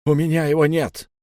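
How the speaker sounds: loud, high desperate shout; shouted denial. Acted. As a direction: yell it out in panic.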